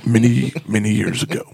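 a light hint of an old space-saga voice; mock sci-fi narrator. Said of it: "Like William Shatner?".

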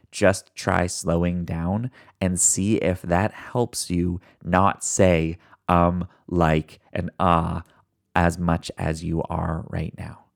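very slow, each word given room; deliberately slowed down. Shown as a demonstration. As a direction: ease right off the pace, unhurried. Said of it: "I slowed down significantly".

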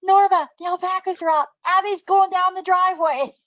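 high, loud urgent call; retold yell. Yelling to sister that the alpacas are out, retold urgent shout. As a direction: re-enact hollering a warning across the yard. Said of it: "And I yelled at her".

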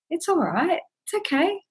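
put-on soothing a child. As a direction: coo it like calming a little one. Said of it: "would we pick them up and take a moment and say".

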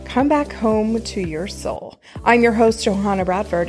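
ordinary talk

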